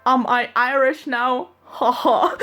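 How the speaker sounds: deliberately bad, high Irish accent; Mark's terrible Irish accent. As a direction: butcher an Irish accent for a laugh. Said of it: "Mark's terrible, really, really terrible Irish accent".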